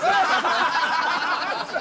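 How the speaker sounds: loud high cackle at the joke; high braying laugh. Acted. Pub friends laughing at a joke, loud braying laugh. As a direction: roar with laughter.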